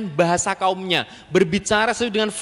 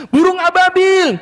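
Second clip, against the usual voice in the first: very high, loud, drawn-out cry; children's storytelling; 12 semitones higher, 8 dB louder, slower than usual. Shown as a demonstration. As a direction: announce the birds to enthralled children. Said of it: "saya pakai gitu-gitu". (seruan pendongeng, nyaring dan panjang)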